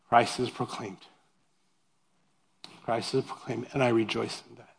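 quiet reverent. Retold or imitated. Paul's quiet contented conclusion.

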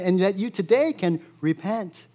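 ordinary delivery

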